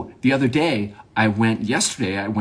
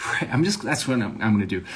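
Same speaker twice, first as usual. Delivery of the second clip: ordinary talk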